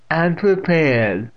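put-on loud, high, slow old woman; deaf old aunt declaring. A narrator's reading. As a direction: declare it slowly and loudly.

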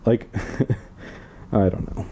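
soft chuckle; laughing under breath. Real, not acted. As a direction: laugh it off quietly. Laughing trailing aside.

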